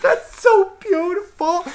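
tiny, very high squeak; comically high, choked-up aside; mock tearful whisper. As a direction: squeeze it out as if secretly crying. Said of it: "I whispered, like, to my other personality".

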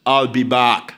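deep, low Terminator voice; Arnold Schwarzenegger impression. Pastor hamming up the Terminator for the church, deep and low. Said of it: "my best Arnold Schwarzenegger impersonation".

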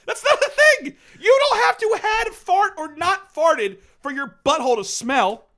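loud, high-pitched comic outrage; incredulous outburst. Real, not acted. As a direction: protest loudly at an absurd idea. Outraged rebuttal of co-host's question.

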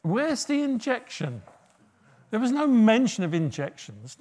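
ordinary delivery

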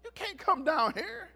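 very high, startled protest; high-pitched mock dismay; young self objecting. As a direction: squeak it like an embarrassed kid. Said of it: "And I looked up like".

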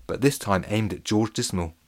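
plain reading voice

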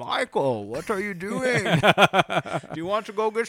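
high, slow muffled Bane voice; Bane impression. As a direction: cup your hands and go full Bane. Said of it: "she has the best Bane impression".

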